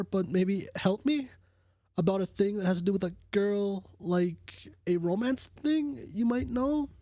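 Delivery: a lightly put-on voice for the person asking for romance advice; voicing the other user. Reads out another user's message.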